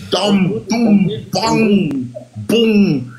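bang out each hit; punch sound effects. Retold or imitated. Imitating punches landing.

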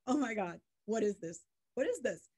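mock-appalled, pitch lifting on the repeat; appalled disbelief, lightly acted; retold dismay. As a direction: react aghast, as in a retelling. Retold shock at opening the spreadsheet.

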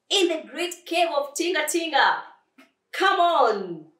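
loud, high rallying call; rally a friend to follow; excited urging. Acted. Flea leading the lion to the cave, excited.

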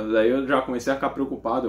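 ordinary talk, nothing marked

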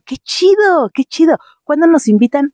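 high, loud delighted cry; excited. Real, not acted. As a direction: squeal with delight. Excited at fan greeting.

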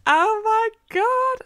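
high, drawn-out swoon; fangirl swooning. Retold or imitated. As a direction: stretch it out high and gushing. Acts out ecstatic first-read reaction.

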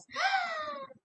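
high shocked gasp; sharp high gasp. Shown as a demonstration. Skylar reacting to the principal's office, high gasp.

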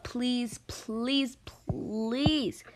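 high, drawn-out begging; pleading. Real, not acted. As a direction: plead harder with each please. Begging viewers for game secrets.

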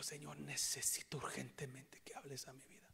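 hushed plea to the Lord; whispered retold prayer. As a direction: pray it under your breath.

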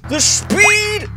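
very high excited squeal; thrilled squeal. Real, not acted. Player speeding in the truck, high thrilled squeal.

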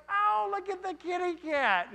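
high, loud sing-song coo at a kitten; wife's cooing voice. Retold or imitated. Wife cooing at a stray cat in the Holy Land. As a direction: coo over the cat like a delighted child. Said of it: "And she's like".

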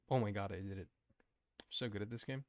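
usual speaking voice

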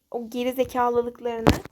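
normal talking voice

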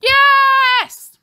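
high, loud shouted yes; crowd cheer. A narrator's reading. Pupils cheer back at their leader, shouting. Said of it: "cheered the crowd".

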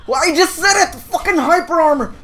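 high-pitched indignant complaint; exasperated outburst. Real, not acted. Gamer beaten by hyper armor, exasperated. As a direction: yelp in frustration at the game. Hit by move just mentioned.

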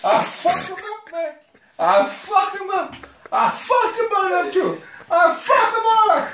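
building Scarface rant. Pretend. Doing Scarface, builds his threat to a shout. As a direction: start tough and build to a shout. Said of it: "Do Scarface and Tony Montana".

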